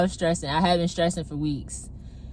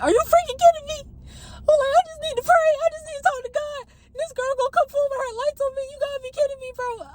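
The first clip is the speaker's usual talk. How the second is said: shrill-free but much higher, near-yelling disbelief; exasperated high outburst; faster than usual. Retold or imitated. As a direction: blow up in frustrated disbelief, voice shooting up. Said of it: "I'm pissed ... livid".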